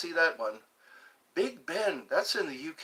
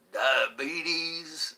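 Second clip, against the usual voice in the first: drawn-out southern drawl; low southern accent; 2 semitones higher than usual. Pretend. Viewer asked for it, low southern accent. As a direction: say it with a slow Southern twang. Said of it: "in low southern accent".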